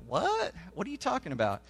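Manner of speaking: high, quiet "what?" of confusion; baffled disciples. Retold or imitated. Disciples asking Jesus about the parable, baffled. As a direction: imitate puzzled listeners, pitch jumping up on what. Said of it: "They don't understand".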